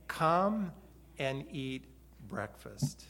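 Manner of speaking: soft and slow, fading to almost nothing; a pastor slowing down so the words sink in; tender invitation. Shown as a demonstration. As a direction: let each word rest on the heart. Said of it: "I want these words to rest on your heart".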